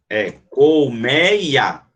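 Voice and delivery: colmeia stretched out very slowly, col-mei-a; slow syllable split. Shown as a demonstration. Teacher correcting the split for students, very slowly. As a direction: spell out colmeia beat by beat. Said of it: "Pode ser assim? Não".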